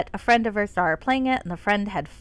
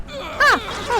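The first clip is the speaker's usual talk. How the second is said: startled yelp; 13 semitones higher, 4 dB louder than usual. Real, not acted. Sudden yelp during game.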